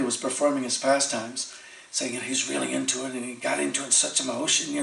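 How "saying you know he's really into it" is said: usual speaking voice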